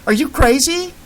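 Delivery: high panicked cry. Acted. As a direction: shriek it in disbelief. Captive girl panicking.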